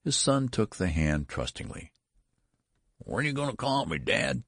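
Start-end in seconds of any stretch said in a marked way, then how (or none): 3.0-4.4 put-on high, quick old son's quaver. A narrator's reading. Old son asks father as they leave the nursery, high quavering. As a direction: quaver it high, like an aged child. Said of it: "he quavered".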